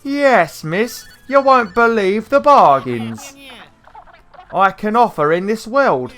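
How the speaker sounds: high, drawn-out Cockney salesman snake; Trouser, Cockney snake. Acted. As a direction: play a slow, loud Cockney snake with a high pitch. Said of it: "doing the Cockney Snake's voice".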